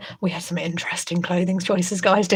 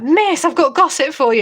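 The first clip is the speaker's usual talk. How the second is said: excited pupil; 7 semitones higher, 5 dB louder than usual. Retold or imitated. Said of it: "they'll be like".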